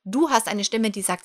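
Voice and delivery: normal talking voice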